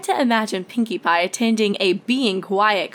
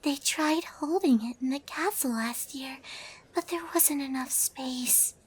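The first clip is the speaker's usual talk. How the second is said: soft, fading murmur; Fluttershy explaining softly in a hushed hall; barely above a whisper; 4 semitones higher, 9 dB quieter than usual. A narrator's reading. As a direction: keep it just above a whisper. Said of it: "her voice barely above a whisper".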